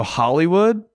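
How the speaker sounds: Hollywood? with a rising question tune; Hollywood? asked upward, noncommittal. Retold or imitated. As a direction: say Hollywood? rising, as if it's not your destination. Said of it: "with a question mark, but it sounded like I wasn't going".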